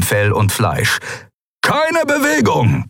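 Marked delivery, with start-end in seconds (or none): 1.6-2.8 very high, slow shouted order; thundered command. A narrator's reading. Guard leader storming the room, thundering. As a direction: thunder it out, drawn long. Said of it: "donnerte ihr Anführer".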